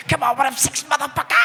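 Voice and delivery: high mock-Scottish yelp; hammed-up high Scottish shout; fake Scottish accent. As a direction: holler it in silly Scottish. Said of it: "doing that all morning".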